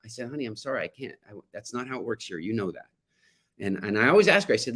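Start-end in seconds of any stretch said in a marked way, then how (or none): 0.3-2.9 gentle to daughter. Retold or imitated. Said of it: "And so I tell her".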